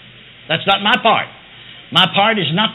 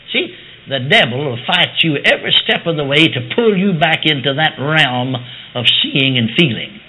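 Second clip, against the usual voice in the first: ordinary talk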